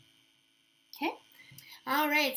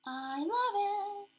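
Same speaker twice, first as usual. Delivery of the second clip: fond delight; 8 semitones higher, much slower than usual. Real, not acted. Admiring finished nail.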